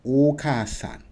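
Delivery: "Okasan." said as one smooth word, no extra a; just say "Okasan." naturally. Shown as a demonstration. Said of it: "You just say".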